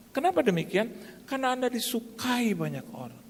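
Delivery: high-pitched, emphatic sermon voice; impassioned preaching. Real, not acted. Preacher telling congregation they will be favoured, high impassioned preaching. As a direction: preach the point with rising fervour.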